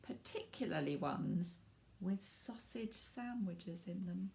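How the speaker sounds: lively storybook narration for little ones; reading to children. A narrator's reading. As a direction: read it like bedtime story time. Reads picture book to children.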